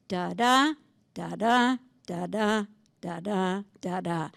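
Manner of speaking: "da, da" beats with the second da stressed; iambic da-DA rhythm. Shown as a demonstration. Teacher demonstrates iambic meter to students, stressing each second da. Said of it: "they have to be iambic".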